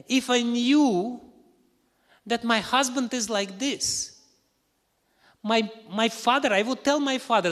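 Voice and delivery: high, loud indignant outburst; angry wife. Acted. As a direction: rage at him, pitch way up. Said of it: "she became very angry".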